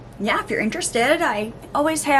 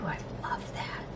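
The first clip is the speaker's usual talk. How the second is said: breathy hushed delight; awed whisper; 13 dB quieter than usual. Real, not acted. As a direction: whisper it like a secret love. Whispered delight over swatch.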